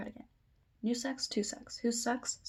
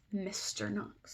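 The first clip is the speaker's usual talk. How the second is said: ordinary delivery, nothing marked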